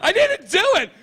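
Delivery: high accusing voice; accusing girl. Retold or imitated. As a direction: accuse Becky sharply. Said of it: "She goes".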